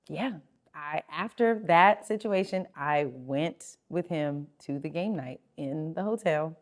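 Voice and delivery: ordinary delivery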